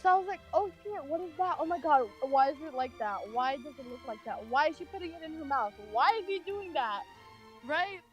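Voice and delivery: ordinary delivery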